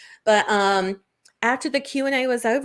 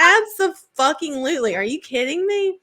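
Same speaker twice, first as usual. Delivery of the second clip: higher, louder, laughing yes; high laughing enthusiasm; gleeful; 7 semitones higher, 5 dB louder than usual. Retold or imitated. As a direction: answer with giddy glee. Retold enthusiastic answer to friend.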